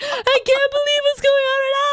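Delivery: high, breathless fangirl squeal; freaking out in awe; starstruck disbelief. Retold or imitated. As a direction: squeal it like you can't believe it. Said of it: "I was like".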